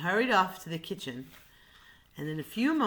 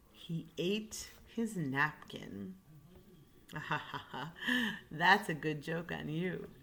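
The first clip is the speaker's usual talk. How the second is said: plain reading voice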